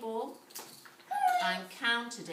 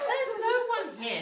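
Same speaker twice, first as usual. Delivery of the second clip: high, loud innocent denial; innocent fib; 14 semitones higher, 7 dB louder than usual. A narrator's reading. Wife lying to her husband, high innocent voice. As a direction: cover for the boy brightly.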